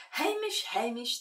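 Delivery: high, loud call of the name. A narrator's reading. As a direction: call the cat from afar. Calling the cat's name.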